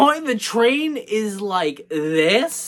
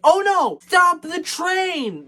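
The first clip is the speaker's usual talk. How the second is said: mock scream; 10 semitones higher than usual. Engineers spotting headlights on the track, mock screaming. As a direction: imitate someone yelling in alarm, pitched way up. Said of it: "They're screaming".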